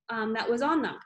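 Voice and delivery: ordinary delivery, nothing marked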